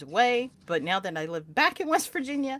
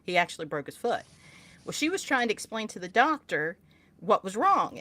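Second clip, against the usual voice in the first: usual speaking voice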